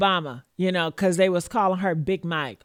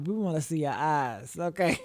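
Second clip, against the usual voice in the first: quiet sly aside; soft teasing aside; 5 semitones lower, 6 dB quieter than usual. Real, not acted. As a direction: lower and softer, a murmured tease.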